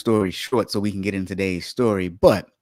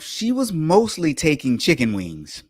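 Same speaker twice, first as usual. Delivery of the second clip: ordinary talk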